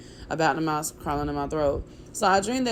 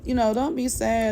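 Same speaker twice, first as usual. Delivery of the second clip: higher, gentle imitation of a little sister; comforting baby sister; 5 semitones higher than usual. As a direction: soothe her like the kid sister would. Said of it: "She's like".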